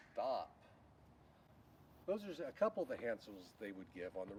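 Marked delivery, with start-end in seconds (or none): 0.1-0.5 low falling call. Shown as a demonstration. Signalman demo giving a firm low falling stop. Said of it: "Might be like".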